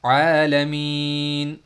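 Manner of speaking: आलमीन drawn out long with a throaty ain; tajweed-style आलमीन; slow Arabic recitation. Shown as a demonstration. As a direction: stretch आलमीन slowly from the middle throat. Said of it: "देखिएगा".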